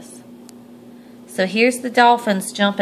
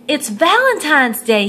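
louder, higher happy call; mild excited call; 6 semitones higher, 5 dB louder than usual. A narrator's reading. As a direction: wake the house with the news. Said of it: "he called out".

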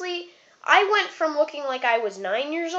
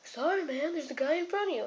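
barber's reply; 3 semitones higher, 8 dB quieter than usual. Retold or imitated. Voices the barber's brush-off.

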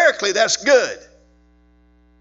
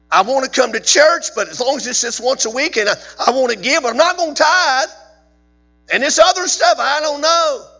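mock churchgoer; 9 semitones higher, 6 dB louder than usual. Mimicking uncommitted churchgoer.

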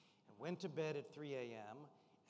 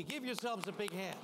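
ordinary delivery

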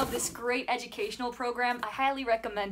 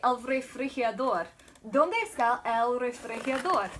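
Spanish demo. Shown as a demonstration. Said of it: "here you'd say".

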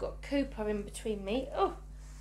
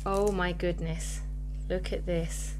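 ordinary talk

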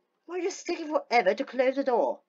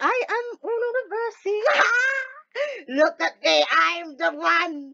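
mock triumphant gloating; 7 semitones higher than usual. Player gloating at the game, hammed-up triumph. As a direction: proclaim victory like a conquering ruler.

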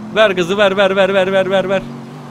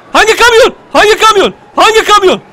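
high, loud repeated demand; panicked question; 12 semitones higher, 9 dB louder than usual. Real, not acted. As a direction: yell it in frantic confusion. Suddenly confused by objective. (panikle bağırarak sorma)

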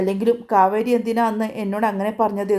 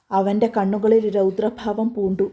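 ordinary delivery, nothing marked

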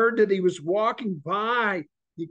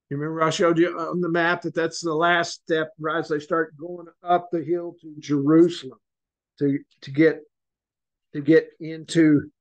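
normal talking voice

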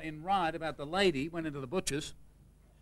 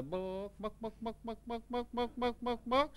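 soft clucking book calls that climb higher; chook clucking; 4 semitones higher, 6 dB quieter than usual. Acted. Chook asking the librarian for a book, clucking it out. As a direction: cluck each book like a hen. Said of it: "Goes into the library and says".

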